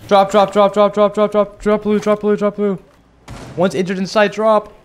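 high, loud rapid callouts; urgent callouts. Real, not acted. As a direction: yell the callouts to the team. Urgent callouts during a firefight.

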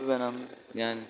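usual speaking voice

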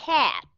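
"Cat" with an open E-like vowel and a clear final t; teacher modelling "Cat"; model word. Shown as a demonstration. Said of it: "phải cho phụ âm ở phía sau".

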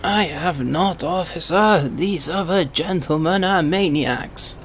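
put-on a doddery old Briton protesting politely; old man voice. Old man voice denying to the officer.

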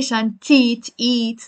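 شنطة ending in a long "eat"; shan-teet with long ee; wrong long vowel. Shown as a demonstration. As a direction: overdo the ee in the ending. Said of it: "It's not really".

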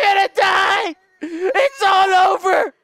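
high, loud, slow wail; despairing shout. Real, not acted. Gamer caught by the monster, despairing wail. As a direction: cry out that it's over.

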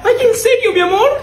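high, delighted mom; mother voice. Acted. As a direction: gush like a proud mother. Kid tells mom, mom answers.

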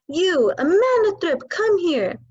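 high, loud bellowing shout of a name. Acted. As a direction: yell the girl's name across the yard. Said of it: "she was shouting".

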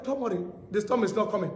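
soft, low dismissal; quiet reassurance. Retold or imitated. Preacher retelling how he calmed storm worries, quiet reassurance. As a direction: drop the voice to brush off the worry.

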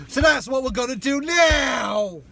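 loud, hammed-up London accent, pitched high. Riffing with his mate on the video plan, mock London accent. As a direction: shout it in mock London geezer talk. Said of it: "You should put a London accent in".

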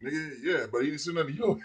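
usual speaking voice

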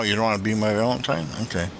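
normal talking voice

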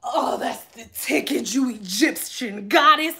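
put-on slow, soft, leering old man; lightly voiced creepy old man purring. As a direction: savor each word like a creepy client. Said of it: "He was like".

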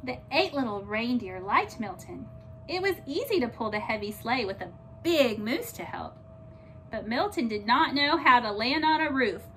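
ordinary delivery, nothing marked

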